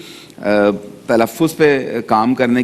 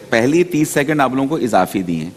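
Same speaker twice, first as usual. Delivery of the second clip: ordinary delivery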